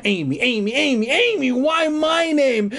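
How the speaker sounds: rising high, distressed chant; hammed-up mounting panic; acted horror. A narrator's reading. Narrator reads scrawled name in horror.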